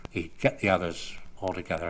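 normal talking voice

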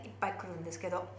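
normal talking voice

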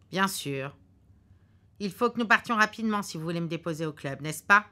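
lightly voiced pressing husband; husband hurrying. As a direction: push her along, a bit quick.

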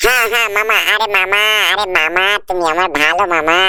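low, teasing cartoon buddy; low cartoon sidekick. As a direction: drop the sidekick voice way down and tease. Sidekick flatters uncle.

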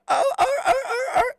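very high excited hollering; hype yells. Real, not acted. Shouting out the crew, hyped hollering. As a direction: whoop like the crowd is hyped.

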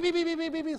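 quick, high, loud beep beep beep; fast electronic beeping; rapid scanner beeps. Shown as a demonstration. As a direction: chirp like a barcode scanner. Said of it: "It's looking for a beep".

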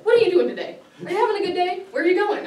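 high, loud chirpy barista greeting; upbeat coffee-shop greeting; cheerful barista. Retold or imitated. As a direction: chirp it like a bubbly morning barista. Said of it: "They're like".

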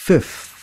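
"Fifth" with a puff of air and the th; 1 breathy fifth. Shown as a demonstration. Teacher shows the th breath in fifth. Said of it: "cắn hơi nó một cái".

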